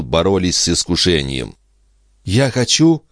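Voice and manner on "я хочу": high-pitched little plea; faltering babble. A narrator's reading. Andrea finally giving in to temptation, babbling high. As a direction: stammer out a wish in a raised voice. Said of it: "пролепетала она наконец".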